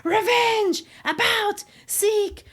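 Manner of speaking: hammed-up angry mob chant; mock crowd screaming. Roman mob calling for revenge, mock screaming. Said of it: "they start screaming".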